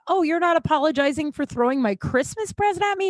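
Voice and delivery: high-pitched mock disbelief; retold indignant challenge. Acts out indignant challenge in quarrel.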